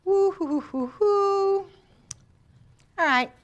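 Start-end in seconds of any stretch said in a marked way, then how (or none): 0.0-1.6 high whooping woo-hoo cheer; excited hooting. Real, not acted. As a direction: whoop it up to wake the room. Teacher hoots to energize class.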